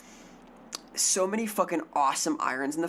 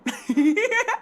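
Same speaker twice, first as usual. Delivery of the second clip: high squeaky giggle burst; a sudden high giggle; squeaky laugh; 13 semitones higher, 4 dB louder than usual. Real, not acted. Streamer giggles at a chat message, high squeak.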